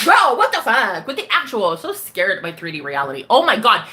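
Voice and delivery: ordinary talk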